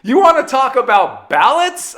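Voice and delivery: loud, high-pitched disbelief, retold; incredulous high outburst at dad; mock incredulity. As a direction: act out an outraged "are you serious". Retelling disbelief at dad's casual call.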